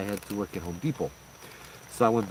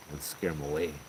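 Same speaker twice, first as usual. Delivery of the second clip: normal talking voice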